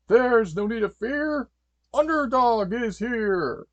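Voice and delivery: loud, high, slow superhero fanfare; heroic proclamation. Acted. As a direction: proclaim it grandly, stretching the words. Superhero announces his arrival.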